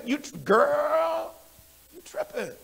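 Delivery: put-on drawn-out, high-pitched "girl"; old slang imitation. Preacher recalling slang from back in the days, playful imitation. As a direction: stretch it out like sassy old slang. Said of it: "We used to say that back in the days".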